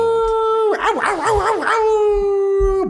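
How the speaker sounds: very high squeaky ow yelps; falsetto yelps. Pretend. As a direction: squeal in mock pain up high. Playful yelps while moving.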